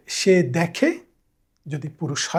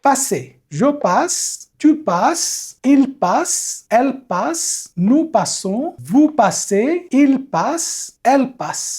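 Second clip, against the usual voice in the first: each form set apart and pitched up; teacher leading the class in a raised chant; higher, recited drill; 6 semitones higher, much slower than usual. Shown as a demonstration. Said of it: "আমার সঙ্গে আপনারা repeat করেন".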